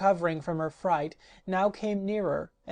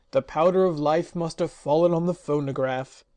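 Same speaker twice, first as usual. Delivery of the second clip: louder, gloomy voice; dismayed magician; 6 dB louder than usual. Acted. Magician realising the powder spilled, gloomy and loud. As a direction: groan it in dismay.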